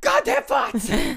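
a very high, slow, dragged-out yell; a hammed-up imitation of a scream of rage; screamed curse. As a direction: shriek it out like he's losing his mind. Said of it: "he literally loses it".

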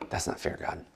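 whispered, petulant complaint; sulky whisper. Retold or imitated. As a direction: breathe it like a sulking child. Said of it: "Jonah's probably like".

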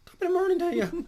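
high, loud hammed-up Irish accent; mock Irish accent. Toasting with Irish cream in a high, loud mock Irish accent. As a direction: belt it like a stage Irishman.

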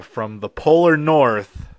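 ordinary talk

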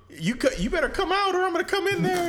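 high put-on Goku taunt; squeaky mock hero calling someone out. Retelling Goku taunting Frieza in a hole, high mock voice.

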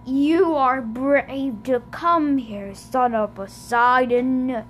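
slow, smooth and oily. A narrator's reading. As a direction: ooze each word out slowly. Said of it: "he said in an oily voice".

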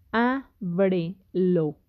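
"Ábrelo" very slowly as á-bre-lo; syllable by syllable. Shown as a demonstration. Teacher setting a home exercise on syllables, syllable by syllable. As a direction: say "Ábrelo" in three spaced beats, á, bre, lo. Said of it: "Porque separamos la palabra en sílabas".

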